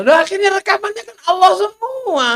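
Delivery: high, loud, triumphant punchline; excited punchline. Acted. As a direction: throw out the punchline with glee. Punchline exclaimed.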